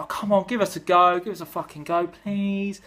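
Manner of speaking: whiny mock plea; begging Labour. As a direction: plead to be given a go. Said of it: "the Labour Party".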